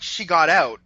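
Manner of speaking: ordinary talk, nothing marked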